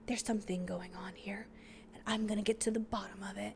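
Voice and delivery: hushed mock-ominous resolve; acted, conspiratorial whisper; dramatic whisper. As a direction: whisper it like a movie heroine vowing to investigate. Acts out heroine's ominous resolve.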